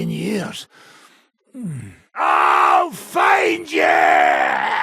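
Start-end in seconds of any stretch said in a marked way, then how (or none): 2.2-4.7 slow, loud drawn-out threat; threatening yell. Acted. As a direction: roar it after the fleeing foe. Threat shouted in combat.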